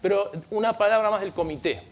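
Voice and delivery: ordinary delivery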